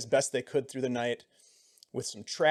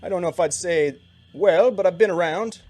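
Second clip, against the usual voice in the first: higher, louder Siluese guide voice; Siluese accent; 4 semitones higher, 5 dB louder than usual. Acted. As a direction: chat easily as the Siluese guide. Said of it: "Siluese accent".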